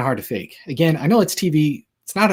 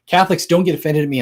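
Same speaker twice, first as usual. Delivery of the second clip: playful teasing; 5 semitones higher, 5 dB louder, faster than usual. Real, not acted. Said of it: "I'm just kidding".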